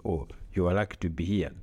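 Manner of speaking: ordinary talk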